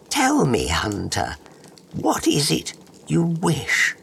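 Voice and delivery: high otherworldly fairy bug; enchanting magical creature voice. Acted. Bug questions hunter.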